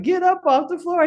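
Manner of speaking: put-on high, child's voice; son's command. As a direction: play the little son ordering her up. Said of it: "was like".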